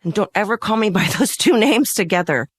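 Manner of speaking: firm demand. Retold or imitated. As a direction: insist sharply, half laughing at it. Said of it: "I said".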